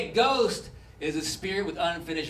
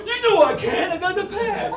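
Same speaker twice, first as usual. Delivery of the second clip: high whiny excuse; 12 semitones higher, 5 dB louder than usual. Acted. One-eyed player answers.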